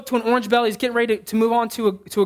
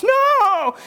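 put-on much higher, louder child's protest; kid's shrill no; 10 semitones higher, 4 dB louder than usual. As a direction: cry out like a kid losing his Xbox. Son protests losing Xbox.

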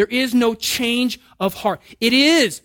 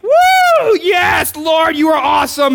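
high whooping praise, much louder; elated shout; 8 semitones higher, 10 dB louder, slower than usual. Retold or imitated. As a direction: shout for joy at the top of the ride. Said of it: "I have these seasons where I'm like".

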